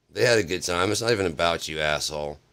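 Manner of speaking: heated imitation of a telling-off; angry scolding. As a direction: put him in his place as the friend. Imitates friend angrily scolding him.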